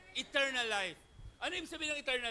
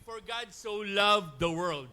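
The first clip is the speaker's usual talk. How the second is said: scripture reading; 4 semitones lower, 4 dB louder, much slower than usual. Real, not acted. Reading the verse aloud.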